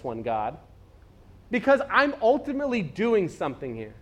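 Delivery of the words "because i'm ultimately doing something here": high, insistent rebuke; God's exasperated rant. Retold or imitated. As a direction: press God's point home. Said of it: "It was like".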